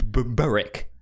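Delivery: ordinary talk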